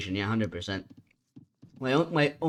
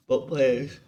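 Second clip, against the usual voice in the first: yawning. Real, not acted. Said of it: "I do apologize for the yawning".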